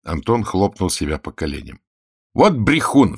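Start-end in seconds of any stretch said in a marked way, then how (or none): 2.3-3.2 high, loud exclamation; raised outburst of indignation; indignant cry. A narrator's reading. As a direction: cry out at a caught liar. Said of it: "вскричал он".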